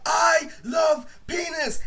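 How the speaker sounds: high, slow shouted line to the street; retold yell. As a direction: bellow it out for everyone to hear. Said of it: "I actually went outside and yelled".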